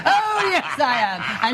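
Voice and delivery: high, sing-out panto comeback; pantomime retort. Pretend. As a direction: insist playfully, as in a pantomime call-and-response. Pantomime call-and-response retort.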